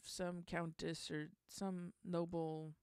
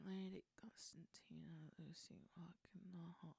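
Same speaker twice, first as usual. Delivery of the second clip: under the breath, counting beats; whispered syllable count; 3 semitones lower, 12 dB quieter than usual. Real, not acted.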